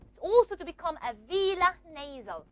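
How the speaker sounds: ordinary talk, nothing marked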